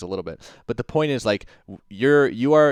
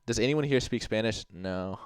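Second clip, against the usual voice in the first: ordinary talk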